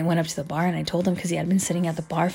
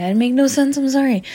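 exasperated; 8 semitones higher, 8 dB louder than usual. Real, not acted. Laughing outburst.

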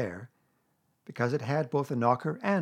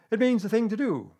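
loud, high-pitched Owl; emphatic Owl explanation; 10 semitones higher, 6 dB louder than usual. A narrator's reading. Owl explaining to a puzzled bear, emphatic. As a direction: spell it out in a raised voice.

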